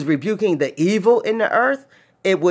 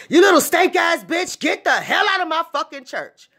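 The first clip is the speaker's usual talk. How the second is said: mad pastor yelling; 12 semitones higher than usual. Retold or imitated. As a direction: scream them out of the church. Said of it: "you be like".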